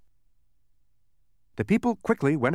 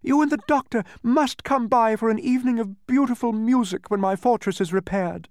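lightly voiced cheerful soundkeeper, higher; a hint of a gracious hostess; 10 semitones higher, 5 dB louder than usual. A narrator's reading.

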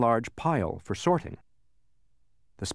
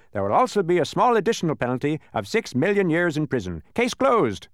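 high, emphatic verdict; judge's pronouncement; 8 semitones higher, 5 dB louder than usual. A narrator's reading. Judge adding a prison penalty, emphatic pronouncement. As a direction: build to a loud closing declaration. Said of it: "he pronounced".

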